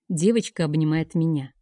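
ordinary delivery, nothing marked

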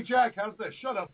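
normal talking voice

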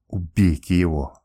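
the princess seething at the insolent captain; hissed. A narrator's reading. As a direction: hiss it with cold hatred. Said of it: "прошипела она".

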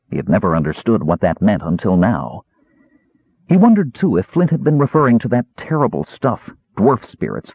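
ordinary delivery

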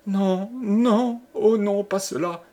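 high, gasping refusal; panicked, high-pitched protest; heart-rending cry. A narrator's reading. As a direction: cry out in anguish. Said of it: "haleta Jeanne dans un cri déchirant".

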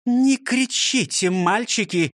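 slow, high reprimand; teacher shushing noisy pupils. A narrator's reading. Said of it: "шикнула на них Фрёкинсталь".

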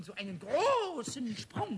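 slow, drawn-out boast; boastful emphasis. Acted. Pumuckl bragging to Meister Eder, slow and emphatic. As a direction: stretch each word to show how big.

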